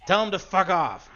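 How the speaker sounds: loud high angry yell; yelled back. Acted. Bandit leader yells his answer to the guard, angry shout. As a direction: bark it across the mine yard. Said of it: "the bandit leader just yells back".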